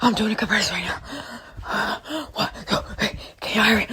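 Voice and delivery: soft, out-of-breath panic; mock breathless panic. Mocking what a panicked caller doing compressions sounds like. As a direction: gasp it out mid-compressions. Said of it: "You're not like".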